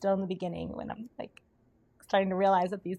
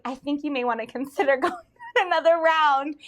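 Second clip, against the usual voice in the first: 1 laughing advice; 7 semitones higher, 7 dB louder than usual. Retold or imitated. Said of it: "I was like".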